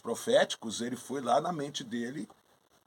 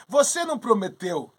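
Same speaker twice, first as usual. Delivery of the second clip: higher, louder mock reproach; mild accusation; 8 semitones higher, 6 dB louder than usual. Apollo confronting his lying little brother, accusing. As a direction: confront him over the broken promise.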